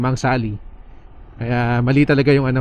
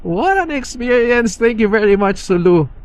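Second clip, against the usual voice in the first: excited; 9 semitones higher than usual. Real, not acted. Thrilled at the end of ride.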